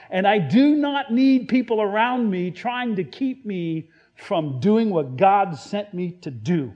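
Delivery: urgent, emphatic acted correction; loving but stern rebuke. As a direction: rebuke him forcefully out of love. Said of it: "this is out of love".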